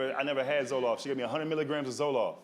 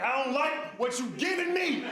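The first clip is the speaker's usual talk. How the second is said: high, slow, drawn-out whine; panicked complaint; 10 semitones higher than usual. Retold or imitated. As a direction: protest to the doctor in mock panic. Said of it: "Lower your voice".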